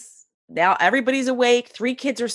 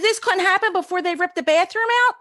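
high-pitched, worked-up complaint; acted exasperation; 11 semitones higher, faster than usual. Woman fretting over her refinished bathroom, high exasperation. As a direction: wail it in mock distress, climbing higher. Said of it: "this is what I'm thinking".

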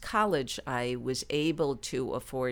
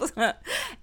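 laugh; 8 semitones higher than usual. Real, not acted. Recalling getting a laugh.

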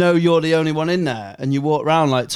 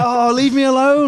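exasperated; 9 semitones higher, 3 dB louder than usual. Retold or imitated. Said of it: "You're like".

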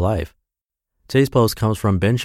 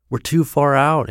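higher, slow and pleading yell; 7 semitones higher than usual. A narrator's reading. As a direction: stretch the words out, scared. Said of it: "Anton yells".

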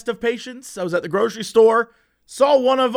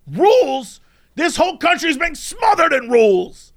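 raised, high ranting shout; mock outrage; 6 semitones higher than usual. Shopper told the rules, mock outraged shout. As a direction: cry out indignantly, imitating a ranter.

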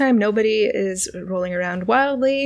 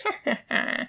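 breathy unvoiced cackle; little cackle; 11 semitones higher, 6 dB quieter than usual. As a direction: cackle softly under breath. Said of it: "She just cackles a little bit".

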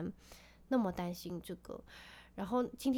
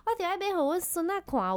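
higher and louder mock grandma retort; imitating grandma; 10 semitones higher, 8 dB louder than usual. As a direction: play the grandmother brushing it off.

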